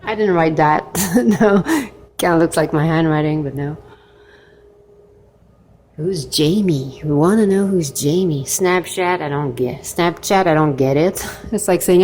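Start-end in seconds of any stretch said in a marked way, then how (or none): none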